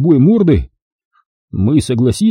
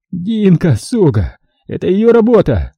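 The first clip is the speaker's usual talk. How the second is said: sputtering foiled villain; 4 semitones higher than usual. Acted. Bandit blames accomplice.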